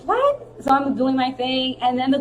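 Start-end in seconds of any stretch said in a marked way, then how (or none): 0.1-0.5 high-pitched mock "what"; baffled. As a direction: squeak it up in retold disbelief. Retold baffled reply to coach.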